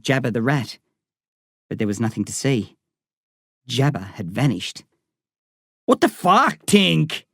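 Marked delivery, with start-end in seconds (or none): 5.9-7.2 higher angry outburst; boy yelling at his friend for letting the rat go; angry shout. Acted. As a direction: snap it out in anger. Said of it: "Darren shouted".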